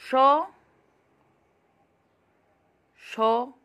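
each syllable called out loud and separate; loud alphabet recitation; loud syllable drill. Shown as a demonstration. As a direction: call each sound out clearly, like class recitation. Reads alphabet syllables aloud.

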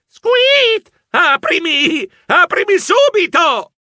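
high, loud, slow shouting; locked-out mouse yelling to be let in. A narrator's reading. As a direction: pound the door and yell.

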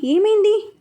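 high, eager asking; worried bystanders asking; anxious question. A narrator's reading. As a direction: ask quickly in alarm. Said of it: "అని అడిగారు ఆత్రంగా".

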